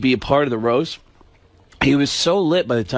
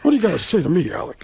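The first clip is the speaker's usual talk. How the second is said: Pacino impression; 4 semitones higher, faster than usual. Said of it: "And he says".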